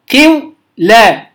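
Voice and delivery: قو لا drawn out slowly, syllable by syllable; slow syllable demo. Shown as a demonstration. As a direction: stretch قو لا into slow separate syllables. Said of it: "فنقلوا كسرة الواو إلى القاف". (نطق قو لا ببطء مقطعًا مقطعًا)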